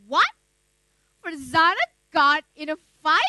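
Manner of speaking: incredulous question with a loud punch; can't believe what was just heard; astonished. Acted. Narrator hearing that the shy girl fought, astonished. Stunned reply to news.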